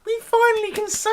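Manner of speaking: excited squeal. Acted. Celebrating the spoiler.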